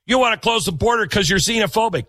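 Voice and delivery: mock accusation. Mocking critics' accusation.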